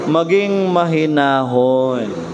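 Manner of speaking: maging mahinahon drawn out very slowly; slow, deliberate. Shown as a demonstration. Pastor urges the congregation to stay calm, very slow and deliberate. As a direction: let maging mahinahon sink in, each word slow.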